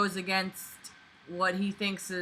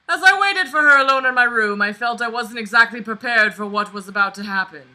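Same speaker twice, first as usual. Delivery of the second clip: louder, higher melodramatic read; dramatic story reading; 5 semitones higher, 11 dB louder than usual. Acted. Reading the fanfic's build-up aloud, hammed-up drama. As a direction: oversell the suspense.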